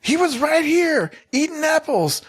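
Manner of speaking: high, loud mock yell at the team; hammed-up screaming, way up in pitch; retold scream. Drunk Trapper yelling about the apples, high mock scream.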